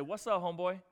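breezy, over-familiar hail; mock casual greeting. John sees glorified Jesus, mock over-familiar greeting. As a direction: greet him like an old buddy.

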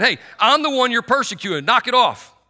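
stern rebuke. Retold or imitated. Preacher voicing Jesus telling Saul to knock it off, loud and stern. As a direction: tell him firmly to knock it off. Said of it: "that's what Jesus is saying".

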